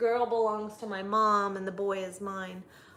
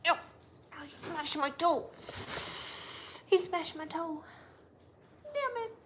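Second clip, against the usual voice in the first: pained whine; 9 semitones higher than usual. Real, not acted. Dog stepped on toe.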